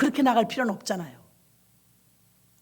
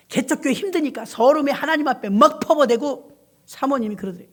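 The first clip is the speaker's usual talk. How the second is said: usual speaking voice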